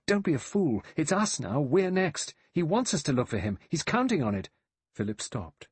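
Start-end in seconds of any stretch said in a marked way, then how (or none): 0.1-4.5 high-pitched frantic pleading; urgent cry. A narrator's reading. Vera clings to Philip to stop him, crying out. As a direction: plead in quick high bursts. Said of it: "She cried".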